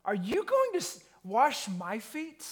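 high, incredulous indignant question; outraged that the master would stoop. Retold or imitated. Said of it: "Peter says to Jesus in an indignant way".

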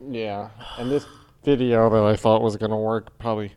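low, strange alien voice. Pretend. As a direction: talk like a spaced-out alien. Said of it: "Are you doing an alien voice?".

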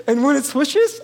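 ordinary talk, nothing marked

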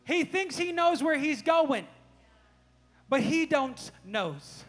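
loud, slow, emphatic scolding; freaking out. Retold or imitated. Dad explaining to son that his brother is lost, worked up and emphatic. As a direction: hammer it home to the child, word by word. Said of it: "I'm kinda freaking out".

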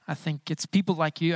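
normal talking voice

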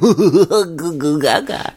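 put-on slow, laughing baby babble. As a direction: coo it like a baby. Baby talk while laughing.

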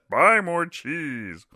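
high, slow squealing pig yell; pig's voice. Acted. Vlogger voicing the comic pig screaming his advice. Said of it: "that's what he screams".

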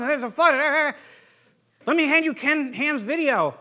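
mock his old self hammering proof at people; pushy arguing. Mocking his old pushy witnessing.

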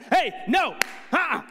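put-on loud, high startled shout at the kids; alarmed shout. Dad walking in on sons with tweezers, alarmed shout. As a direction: yell out a sharp parental stop. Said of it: "make noises at your children".

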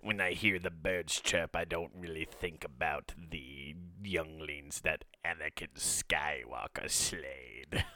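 low, soft evil emperor voice; a sinister old ruler musing; Emperor Palpatine. Pretend. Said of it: "Emperor Palpatine trying to do words of affirmation".

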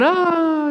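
high drawn-out mock "aah"; hammed-up wail of someone falling; mock falling scream. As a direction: cry out as if plunging into an abyss. Knight cast into the abyss.

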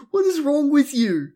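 high, loud, incredulous scolding; exasperated yell. Real, not acted. Podcaster scolding Tai on screen, loud high exasperation. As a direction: yell at him, fed up.

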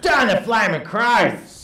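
slow, broad Australian exclamation; Australian accent. Retold or imitated. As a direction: drawl it like an old Aussie. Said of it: "vehement anger in your voice".

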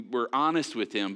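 ordinary talk

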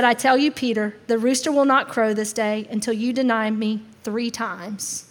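matter-of-fact Jesus. Acted. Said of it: "He just simply stated the facts".

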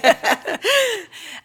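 high, loud peal of laughter. Real, not acted. Host after a joking apology, laughing hard.

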